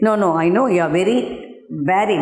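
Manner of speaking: quick rushed paraphrase of the spider; fast retelling. Acted. Teacher hurrying through the spider's words, quickly. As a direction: rattle it off quickly. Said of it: "he said".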